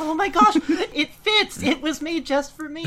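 mock delight. Friend teasing another about a perfect fit, mock-delighted. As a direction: gush in playful, exaggerated delight.

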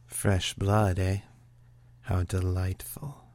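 lightly voiced sultry seductress; a hint of a purring temptress; sultry Morgan. Medical examiner teasing the new detective, lightly sultry. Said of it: "yet rich and sultry".

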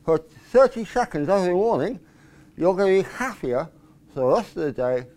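talking with a pen in teeth. Shown as a demonstration. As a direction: speak around a pen held in the mouth. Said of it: "put a pen in your mouth like this".

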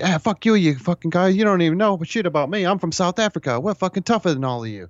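high-pitched, nasal South African rant; nasally South African accent. Retold or imitated. Short-fused character ranting at everybody, nasally South African accent. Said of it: "like, like, nasally accent".

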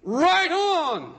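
high, loud, thrilled; preacher voicing Adam in modern slang, delighted; modern excited exclamation. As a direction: cheer like you just found the one. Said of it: "Today we would say".

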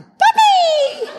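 high thrilled yelp with a laugh; whooping cry. Retold or imitated. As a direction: yell it out with glee. Whooping while speeding past cars.

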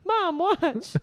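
put-on high, excited child voice; kid calling mom. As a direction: play a kid showing off to mom.

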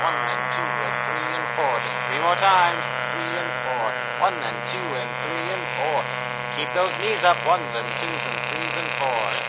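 loud aerobics instructor calling counts; barking gym coach. Acted. Leading an aerobics class.

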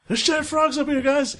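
high, loud mock alarm. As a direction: play up a silly panic. Joking alarm.

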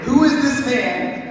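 high, loud, slow question of stunned disciples; awestruck alarm. A narrator's reading. Terrified disciples asking each other about Jesus, awestruck alarm. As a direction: cry it out in shaken disbelief.

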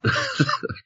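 short squeaky giggle; a quick high giggle; high laugh. Real, not acted.

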